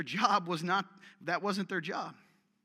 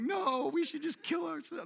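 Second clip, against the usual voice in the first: mock despair; 11 semitones higher than usual. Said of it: "the women are like".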